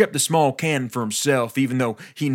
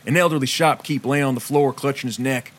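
usual speaking voice

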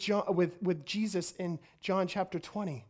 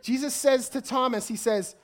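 ordinary talk

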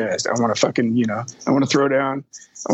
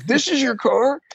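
high, incredulous question; acted amazement; 12 semitones higher than usual. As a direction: imitate someone who can't believe it. Vinny amazed at the muscle car.

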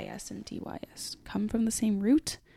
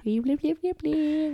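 higher, playful blip noises; silly baby noises; 7 semitones higher, 3 dB louder than usual. Pretend. Joking about future foster kids, playful higher blips. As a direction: bubble the blips out like a toddler. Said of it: "they will sound like these".

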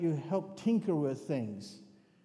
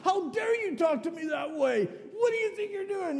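much higher, louder indignant shouting; a furious policeman yelling at a cheeky kid, much higher and louder; berserk outburst; 13 semitones higher, 3 dB louder than usual. Retold or imitated. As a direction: retell the cop's outraged shout at full tilt. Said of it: "This guy just goes berserk".

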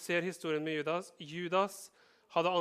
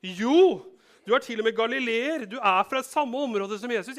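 high, loud insisting voice; insistent accusation; 5 semitones higher, 9 dB louder than usual. Acted. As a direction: pin him down, won't let it go. Accuser insists Peter is Galilean. (høy, insisterende anklage)

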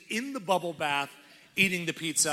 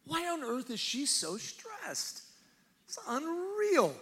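high, drawn-out disbelief; mock-baffled dad; 10 semitones higher, 4 dB quieter, slower than usual. Clueless dad about his stressed wife, mock-baffled. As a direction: stretch it out slowly in high, put-on bewilderment. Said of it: "He's like".

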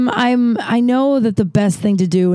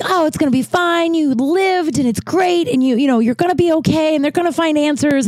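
upbeat self-talk; 9 semitones higher than usual. Retold or imitated. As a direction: sing yourself a pep talk. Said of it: "some days I'm like".

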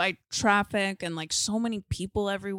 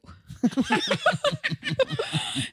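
high laugh; 5 dB louder than usual. Real, not acted. Reacting to the quote.

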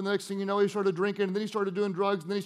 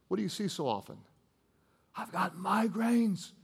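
intimate soft preaching; 2 semitones lower, 4 dB quieter than usual. Acted. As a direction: lean in and almost whisper to them.

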